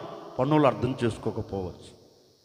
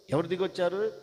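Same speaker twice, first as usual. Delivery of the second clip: ordinary delivery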